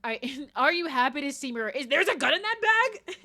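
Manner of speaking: hammed-up questioning. Man mangling his English line to her, hammed-up questioning. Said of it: "He's like".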